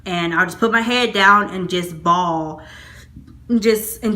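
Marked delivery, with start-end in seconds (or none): none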